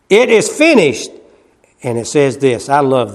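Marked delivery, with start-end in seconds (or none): 0.1-1.1 high, loud "It is finished"; triumphant declaration. Acted. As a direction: proclaim it in triumph. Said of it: "he said".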